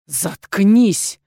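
громко процеди сквозь зубы; злой, сдавленный выкрик; злое шипение сквозь зубы. A narrator's reading. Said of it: "сквозь зубы прошипел Тим". (прошипеть сквозь зубы)

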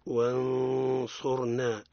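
وانصرنا with the ن hidden into ص, held ghunna, slow; ikhfa example. Shown as a demonstration. Teacher showing students the hidden nun, slow and held. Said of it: "مثال للصاد".

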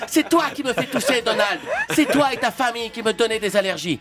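high indignant character voice; high cartoon voice. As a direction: retort in a silly falsetto. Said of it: "Hillary lui répond".

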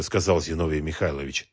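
ordinary delivery

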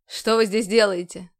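harsh voice. A narrator's reading. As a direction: bark it like a stern commander. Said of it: "резкий голос".

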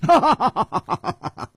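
high, hearty stage laugh; big laugh. A narrator's reading. Swordsman laughing at his wounded rival, big laugh. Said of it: "南宫绫大笑".

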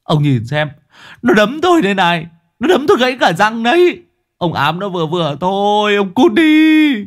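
high, furious complaint building to a yell; worked-up victim ranting at the culprit; aggrieved outburst. Acted. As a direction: vent the grievance, ending in a loud scream. Injured man blames the ghost.

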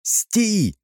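high-pitched alarmed challenge; sentry's cry. A narrator's reading. Sentry challenges strangers, cried out high. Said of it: "выкрикнул часовой".

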